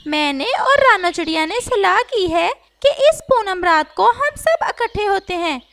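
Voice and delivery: rally the birds together; a parrot speaking to a crowd of birds; parrot addressing the gathering. Acted.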